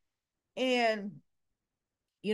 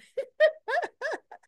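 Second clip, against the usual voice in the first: high laugh; 7 dB louder than usual. Real, not acted. Amused by Martin's plea.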